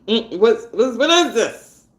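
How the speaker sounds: normal talking voice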